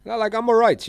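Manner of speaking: sudden loud, high refusal; loud high-voiced denial; loud high protest. Real, not acted. Refusing food temptation.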